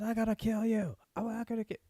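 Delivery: high-pitched mock-crazed voice; raving madman. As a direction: play a ranting madman in falsetto. Plays raving McCoy chasing man.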